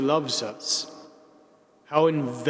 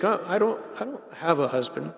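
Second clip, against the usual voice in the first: sheepish; 4 dB quieter than usual. Retold or imitated. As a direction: imitate someone sheepishly owning up, tripping over the first words. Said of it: "quite sheepishly, is like".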